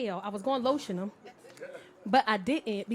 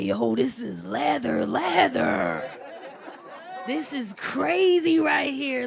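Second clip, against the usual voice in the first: put-on amazed young guy; 9 dB louder, much slower than usual. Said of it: "He like".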